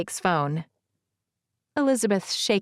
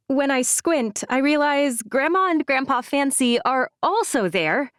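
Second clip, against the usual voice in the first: ordinary delivery, nothing marked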